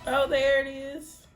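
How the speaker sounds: relieved discovery, drawn out; surprised. Real, not acted. Spots the money again.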